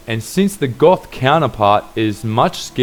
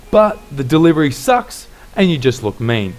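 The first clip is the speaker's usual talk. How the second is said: ordinary talk, nothing marked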